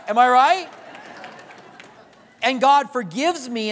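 ordinary delivery

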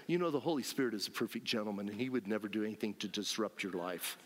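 low, quick, soft mock objection; mock objector. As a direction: rattle it off low, imitating a pious objector. Voices people who object to him.

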